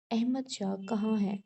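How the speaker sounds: ordinary delivery, nothing marked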